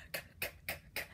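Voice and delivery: voiceless "K, k, k" catches; a soft choke in the throat; quiet choking. Shown as a demonstration. Teacher models the quiet k sound for kids. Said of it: "Quiet choking".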